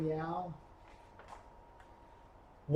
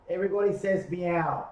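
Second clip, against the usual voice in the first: usual speaking voice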